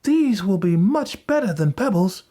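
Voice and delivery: put-on high-pitched boy's voice; Hansel. A narrator's reading. Hansel pocketing the treasure, high boyish voice. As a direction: speak up as a pleased little boy.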